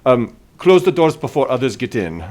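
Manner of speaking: in-character order to strangers; unseen temple voice. Acted. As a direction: call out to intruders to keep out. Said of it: "as a voice says".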